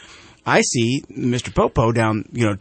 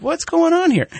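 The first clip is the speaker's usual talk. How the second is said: sudden high-pitched alarm, retold; alarmed surprise. Driver startled by the car tailing him, high alarmed exclaim. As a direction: squeak it out, caught off guard by the tailing car. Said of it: "And I'm like".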